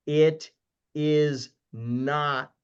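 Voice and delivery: IT, IS and NOT set apart by pauses; emphatic, word by word. Real, not acted. Speaker tells investors what a mortgage note is, emphatically word by word. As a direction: land separately on "it", "is", "not". Emphatic legal correction, then repeats it.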